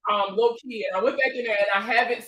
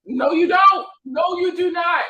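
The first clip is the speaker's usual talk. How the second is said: louder, higher mock outrage; incredulous denial; 7 semitones higher, 4 dB louder than usual. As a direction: deny it loudly, half joking. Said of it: "I'm looking at her like".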